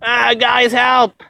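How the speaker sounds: high-pitched hammed-up cry for help; mock cry for help. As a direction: squeal for help like you're falling. Acting out falling in the river.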